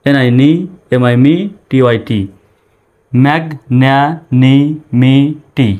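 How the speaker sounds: very slow "ম্যাগন্যানিমিটি", each syllable held separately. Shown as a demonstration. Teacher breaking a hard word down for learners, syllable by syllable. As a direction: split "ম্যাগন্যানিমিটি" into syllables with clear gaps. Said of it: "ভেঙে ভেঙে উচ্চারণ করার".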